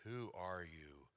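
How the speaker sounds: very slow, soft and low; hushed, thrown off balance; stunned quiet. Retold or imitated. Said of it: "this man got really quiet".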